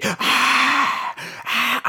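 high breathy sigh; mock weary sigh. Host faking a sad crowd reaction with a sigh. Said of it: "listen to that crowd going wild".

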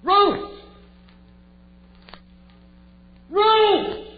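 loud searching call. Acted. David searches the house for Ruth, loud call. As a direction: call through the dark house.

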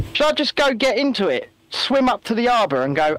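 ordinary delivery

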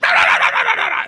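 rapid-fire high yapping bark; shrill-pitched yappy dog noise; imitated dog bark. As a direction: mimic a small dog barking furiously. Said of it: "Chloe runs up and goes".

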